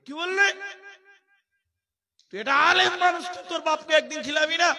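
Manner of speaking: high, loud enraged shout; furious uncle. Acted. Village elder scolding the scholar son, furious shout. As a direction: bellow it at the scholar son in outrage. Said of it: "চাচা খুব রেগে গিয়ে বলছে".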